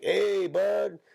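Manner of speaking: put-on high, drawn-out Mexican-accented complaint. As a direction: stretch it slowly in a caricatured Mexican accent. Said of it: "They all sound like".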